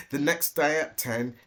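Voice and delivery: plain reading voice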